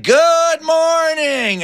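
high, drawn-out singsong greeting; happy wake-up call. Acted. Wally jumps out of bed, happy singsong. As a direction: greet the day in long, high, happy notes. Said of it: "he woke up happy".

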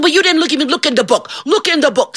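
high, urgent urging; insistent. Acted. John urges Saint Peter to check, insistent. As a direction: press the point in a high voice.

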